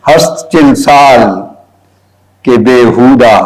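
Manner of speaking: very slow recital with long pauses; verse dictation. Shown as a demonstration. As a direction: dictate the Persian couplet phrase by phrase. Dictates a Persian couplet. (शेर को रुक-रुक कर, धीरे-धीरे लिखवाते हुए)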